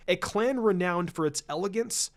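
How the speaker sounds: plain reading voice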